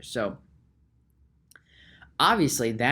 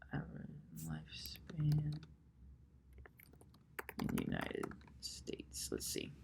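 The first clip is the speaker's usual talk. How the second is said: softer, breathy whisper read while typing; whispered muttering; 4 semitones higher, 15 dB quieter than usual. Real, not acted. Muttering the search while looking up lifespans. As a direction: murmur the search words to yourself.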